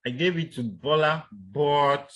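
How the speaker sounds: "but" held long on one pitch; level intonation. Shown as a demonstration. Teacher showing students a level tone before continuing, level intonation. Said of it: "that level".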